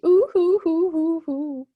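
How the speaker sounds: loud, hammed-up boo-hoo sob; mock crying. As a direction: wail like a baby for effect. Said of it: "You can hear the crying now".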